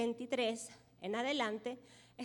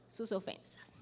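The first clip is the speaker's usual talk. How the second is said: whisper; 4 semitones lower, 10 dB quieter than usual. Acted. Preacher drops to a hush.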